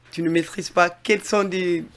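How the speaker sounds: normal talking voice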